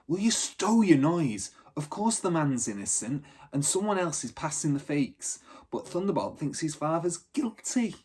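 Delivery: Bridie scolding. Acted. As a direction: shut him up firmly, like a tough street girl.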